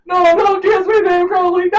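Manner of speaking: high, loud squealing protest; playful high-pitched no; mock panicked refusal. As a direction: shriek him away in mock horror.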